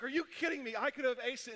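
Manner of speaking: high-pitched, indignant outburst; exasperated disbelief. Retold or imitated. Student learning of the test's trick, exasperated. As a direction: act out outrage at being tricked.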